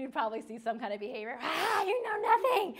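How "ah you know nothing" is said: loud, high mock outburst; hammed-up bratty retort; sassy child. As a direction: act a child hurling back sass before storming off. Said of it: "and some sassiness and walk away".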